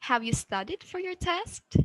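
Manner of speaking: climbing high and staying up on "test"; rising intonation. Shown as a demonstration. Teacher gives a yes-no example, rising intonation. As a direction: push the pitch up through to "test". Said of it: "we have the rising intonation".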